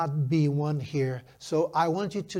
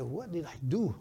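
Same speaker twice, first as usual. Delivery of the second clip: hushed question to himself; retold self-doubt; 7 dB quieter than usual. Young pastor asking himself as the couple cries, hushed self-doubt. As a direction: ask it softly, as a private worry.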